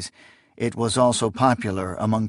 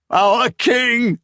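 high, loud grief-stricken cry; anguished cry; 12 semitones higher, 4 dB louder than usual. Acted. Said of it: "Akamian cried".

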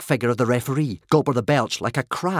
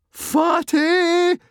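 piercing, very high taunting shriek; 5 dB louder than usual. A narrator's reading. Fishlegs insulting his chief, shrieking. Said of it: "shrieked Fishlegs".

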